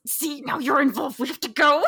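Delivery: high, pressing stage aside; urgent covered aside. Acted. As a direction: push the accomplice to leave, rising at the end. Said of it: "she covers it again and goes".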